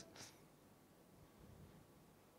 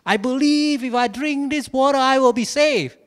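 ecstatic proclamation; 9 semitones higher, 6 dB louder than usual. Retold or imitated. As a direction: leap up and proclaim it with joy. Running around, excited.

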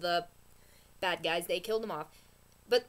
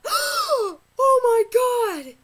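slow, much higher and louder scream; shocked scream; 12 semitones higher, 12 dB louder, much slower than usual. Retold or imitated. Imitating everyone's reaction to the twist, slow screaming cry. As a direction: stretch out a horrified cry.

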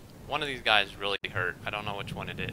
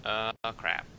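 ordinary talk

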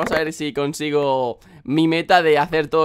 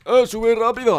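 louder, higher shout to a teammate; urgent call; 6 semitones higher, 4 dB louder than usual. Real, not acted. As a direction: call out to hurry up. Urges teammate to climb.

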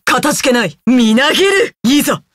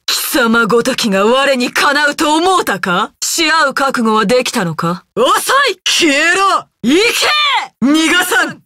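ordinary delivery

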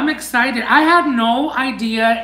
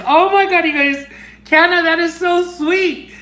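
high, excited thanks; delighted gushing; 5 semitones higher than usual. Real, not acted. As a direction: gush at the sender with pitch up. Delighted by gift stickers.

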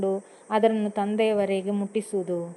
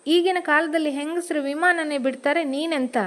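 higher, fast, mildly raised husband's push; mildly raised, impatient husband; a bit louder; 6 semitones higher than usual. Acted. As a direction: nudge her on with a slightly raised voice. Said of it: "ಸ್ವಲ್ಪ ಜೋರಾಗಿ ಹೇಳಿದ".

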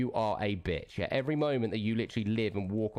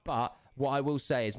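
ordinary talk, nothing marked

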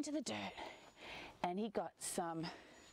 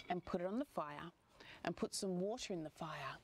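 ordinary delivery

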